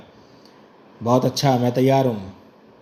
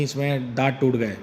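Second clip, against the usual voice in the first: normal talking voice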